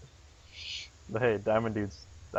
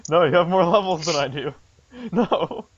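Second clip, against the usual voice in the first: higher, louder frantic protest; desperate pleading; 7 semitones higher, 5 dB louder than usual. Real, not acted. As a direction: beg them not to attack. Pleading as opponent attacks.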